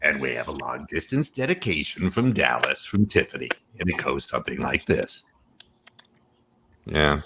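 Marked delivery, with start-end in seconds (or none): 0.0-5.2 lower, softer radio DJ voice; Casey Kasem. Retold or imitated. Reading a long distance dedication as Casey Kasem. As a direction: croon it like a late-night radio host. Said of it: "things like Casey Kasem".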